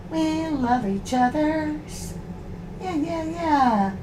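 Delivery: soft singsong dog talk, drawn out. Real, not acted. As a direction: croon it sweetly to calm the dogs. Soothing the growling dogs.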